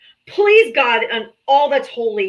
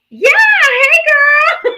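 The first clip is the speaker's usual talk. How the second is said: much higher, louder chirpy phone voice; bubbly friend greeting; 9 dB louder, much slower than usual. Retold or imitated. Mom answering a friend's call, suddenly high and bubbly. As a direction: switch to a sugary girlfriend tone. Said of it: "your voice just changed totally".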